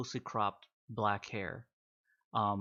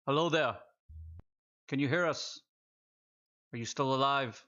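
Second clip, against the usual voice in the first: calling soldier voice; 3 semitones higher than usual. Acted.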